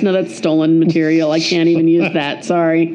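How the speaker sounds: ordinary delivery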